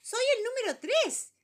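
very high, loud dressed-up voice; his fanciest voice. Acted. Number three proudly announcing himself at the door. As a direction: announce yourself all fancy and proud. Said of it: "con su voz más perifollada".

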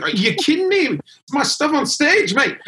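high, loud, outraged Scottish impression; terrible Scottish accent. Singer locked out of the venue, ranting in bad Scottish accent. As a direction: do a bad Scot losing his mind. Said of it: "that's a terrible Scottish accent".

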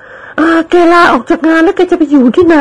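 high female character voice; lady's voice. Acted. Friend asks servant where he will live, high curious voice. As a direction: ask lightly and curiously.

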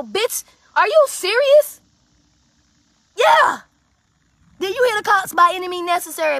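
loud, high, outraged yelling, slowing on the first line; furious shout. Retold or imitated. Yelling at his girlfriend after she mocks the thrown rocks, outraged shout. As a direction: yell at her in disbelief.

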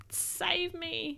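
high, small, drawn-out plea; high, slow cry for rescue; pleading bird. Acted. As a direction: plead softly like a desperate little bird. Bird pleading in the fart cloud.

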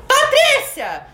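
high, loud call of "Patrícia!"; shouted name for the quiz. Shown as a demonstration. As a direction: belt out "Patrícia!" like a game buzzer. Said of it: "Quem souber vai gritar".

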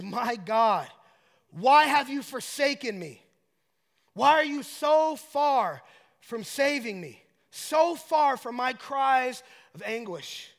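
loud, slow anguished lament; impassioned psalm of complaint; crying out. Acted. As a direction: cry up to God, stretching the words. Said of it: "he is crying out to God".

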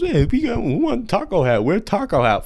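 high, squeaky mock clown sing-song. Mocking the pushy clown who won't leave, in a high squeaky sing-song. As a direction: parody the annoying clown at a ballgame.

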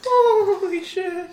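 high, slow, stunned exclamation; shocked. Real, not acted. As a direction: drag it out in disbelief. Shocked by the damage roll.

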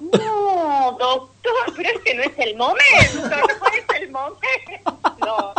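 high, laughing, slow pleading; patient on the operating table, half-laughing panicked plea. Retold or imitated. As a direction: beg the surgeon through nervous laughter. Panicking patient begging surgeon.

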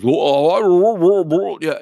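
loud, high blustering mock stammer; flustered boss. Boss told to leave the control room, flustered.

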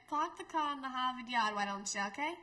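broad Boston accent with dropped R's. Shown as a demonstration. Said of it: "end it off with the Boston accent".

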